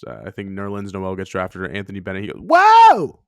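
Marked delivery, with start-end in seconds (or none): 2.4-3.2 very high, very loud mock scream; screamed whoa. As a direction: scream it at the top of your lungs. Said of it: "He just screamed it top of his lungs".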